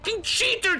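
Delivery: loud high angry rant. Real, not acted. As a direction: spit out the accusation. Raging at overpowered enemy.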